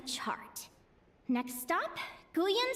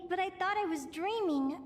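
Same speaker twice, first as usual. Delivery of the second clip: usual speaking voice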